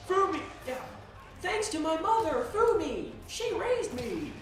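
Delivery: high, strained mock-heartfelt speech; possessed speech. Possessed athlete thanking Fumi in a high, hammed-up speech. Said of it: "making a big speech about it".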